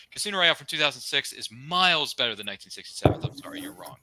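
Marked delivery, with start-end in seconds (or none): none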